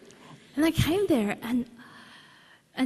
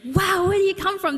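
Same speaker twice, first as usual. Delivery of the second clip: much higher and louder, thrilled; excited; 10 semitones higher, 5 dB louder than usual. Retold or imitated. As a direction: gush at a fellow Australian. Excited reaction to Australian accent.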